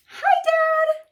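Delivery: very high, loud sing-song hi; high cheerful greeting. Acted. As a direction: call out brightly, way up. Wizard greets father cheerfully.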